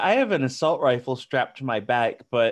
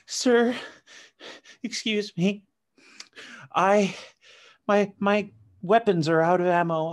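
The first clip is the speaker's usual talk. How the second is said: higher, pleading pitiful voice; pitiful plea; 4 semitones higher than usual. Retold or imitated. Player to stranger, a higher pitiful pleading voice. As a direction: play it helpless and pathetic. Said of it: "I'm gonna be like".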